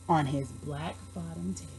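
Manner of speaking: ordinary delivery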